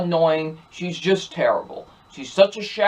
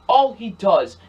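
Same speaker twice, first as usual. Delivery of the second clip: ordinary talk, nothing marked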